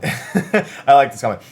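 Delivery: amused laugh. Real, not acted. Laughs at an interview comment.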